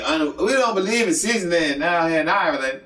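mock Irish accent. Friend mocking Irish cooking in a bad Irish accent. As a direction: put on a joking Irish accent. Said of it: "that was your accent".

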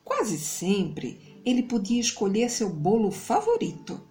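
plain reading voice

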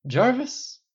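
high, rising call. A narrator's reading. Tony summoning his AI late at night, calling out. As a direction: hail him with sudden inspiration. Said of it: "Tony called out on sudden inspiration".

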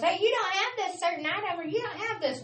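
whiny shopper griping at the cashier; imitating a pushy customer; rude customer. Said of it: "Do not tell us".

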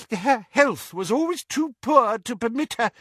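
high, urgent excuse; desperate. A narrator's reading. Doge explaining Ariana's health, desperately. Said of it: "said Doge desperately".

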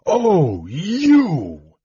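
high, strangled outburst; strangled cry of rage. A narrator's reading. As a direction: choke it out, pitch leaping up. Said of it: "he repeated in a strangled voice".

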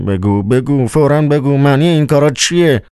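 high, pressing demand in character; desperate questioning, pitched well up; urgent mutter. A narrator's reading. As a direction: plead urgently for an answer, voice climbing high. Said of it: "زیر لب گفت".

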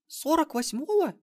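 put-on very high, slow girlish squeak; squeaked question. A narrator's reading. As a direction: squeak it slowly in disbelief. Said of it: "пискнула внучка". (изумлённый протяжный писк внучки)

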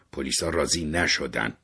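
ordinary delivery

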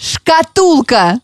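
very slow, over-articulated; slow exaggerated mouthing. Shown as a demonstration. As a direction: stretch every syllable for lip-reading. Mouthing the word for lip-reading.

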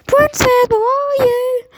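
strange calling voice. Acted. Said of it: "a strange voice calling".

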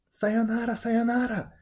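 high, sustained calling-out; cried farewell. A narrator's reading. Momotaro leaving the old couple for his journey, crying farewell. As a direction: call goodbye back to them, high. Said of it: "cried Momotaro".

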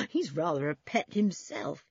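short chuckle before speaking; amused laugh. A narrator's reading. Julian amused by the small boy, short laugh. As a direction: laugh fondly, then talk normally. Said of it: "Julian laughed".

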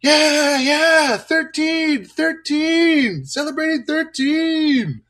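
put-on high, loud kids' chant; kids shouting. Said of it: "kids shouting and screaming".

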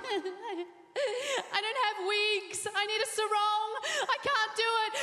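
high, whiny mock pleading; mock whimpering excuse; whiny protest. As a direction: beg off in a put-on high wail. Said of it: "I'm like".

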